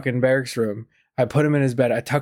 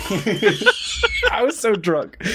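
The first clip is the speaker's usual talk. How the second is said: real laughter stretching out the words, higher pitched; laughing; 8 semitones higher, much slower than usual. Real, not acted. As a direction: crack up while slowly getting it out. Cracks up at own drunk story.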